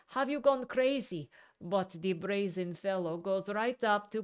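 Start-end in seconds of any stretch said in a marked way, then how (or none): 0.1-1.3 high retold yell; mock yell at the intruder. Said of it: "I follow him and yell".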